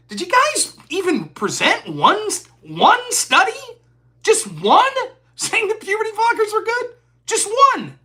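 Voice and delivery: exasperated challenge. Retold or imitated. To debate opponents, incredulous mocking challenge.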